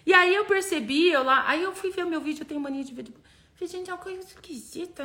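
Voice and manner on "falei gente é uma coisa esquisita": unvoiced, much softer murmur; hushed puzzled aside. Retelling watching her own video, hushed puzzlement. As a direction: whisper your own bewilderment.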